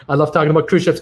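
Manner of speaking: quick words through a laugh; chuckling, fast. Real, not acted. Laughs while confessing.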